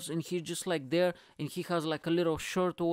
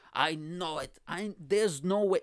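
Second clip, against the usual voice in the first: ordinary talk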